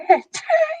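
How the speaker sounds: a squeal of laughter, high and giddy. Real, not acted. Giddy squeal recalling Thor in the records.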